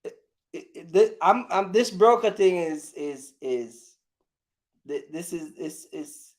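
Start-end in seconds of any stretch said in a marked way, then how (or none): none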